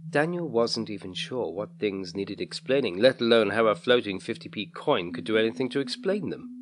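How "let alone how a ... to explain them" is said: plain reading voice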